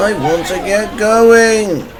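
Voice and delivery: engine's slow, frustrated moan; impatient cry. A narrator's reading. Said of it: "he cried".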